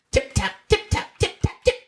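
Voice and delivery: whispered high tip-tap; high voiceless mouse tapping; tapping noises. Acted. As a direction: tap it out like tiny feet.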